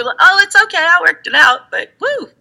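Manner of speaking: very high, chirpy dismissal; breezy brush-off. Retold or imitated. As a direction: wave off the worry cheerfully. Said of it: "And then you're like".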